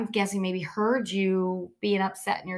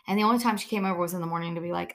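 normal talking voice

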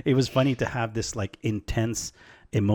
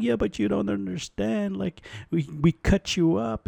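much higher, whiny mock sob; mock crying protest; 11 semitones higher than usual. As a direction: whine up high like a guilty kid pleading. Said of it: "And I'm crying".

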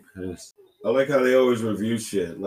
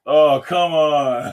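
higher, louder plea; yell at TV; 4 semitones higher, 5 dB louder, much slower than usual. Real, not acted. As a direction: shout it at the screen. Yelling at the TV again.

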